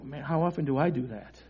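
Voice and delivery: low, soft, to himself; rueful self-reflection. Retold or imitated. Preacher looking at his own life, quietly rueful. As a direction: drop right down as if confessing. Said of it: "look at my own life and go".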